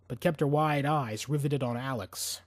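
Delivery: ordinary delivery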